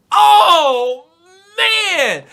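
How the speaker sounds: high, loud burst of excitement; excited exclaim. Real, not acted. Vlogger reacting to the chapter ending, loud excited burst. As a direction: let out the thrill of the cliffhanger.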